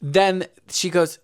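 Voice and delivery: ordinary delivery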